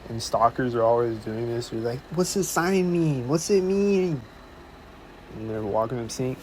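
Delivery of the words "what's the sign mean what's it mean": a bit higher, slow pestering question; lightly voiced pushy stranger; mock nosy stranger. Stalkers pester him about his sign, lightly mocked.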